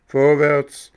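loud gendarme order; barked command. Acted. Gendarme orders the boy to march, loud command.

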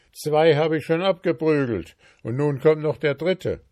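loud, high gruff threat; a dwarf king snarling at the intruder; angry dwarf king. Acted. Dwarf king to goldsmith, threatening loudly.

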